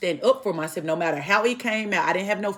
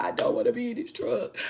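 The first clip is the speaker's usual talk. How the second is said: mild mock whine, pitched higher; retold tearful complaint; 4 semitones higher, 4 dB quieter than usual. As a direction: lightly imitate a tearful complaint. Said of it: "I am crying because I'm just like".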